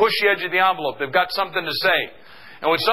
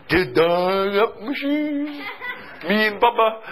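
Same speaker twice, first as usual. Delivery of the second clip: slow, drawn-out film-character voice; movie character impression; 4 semitones higher, much slower than usual. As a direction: play the simple movie hero, words stretched out. Impersonating Forrest Gump about shoes.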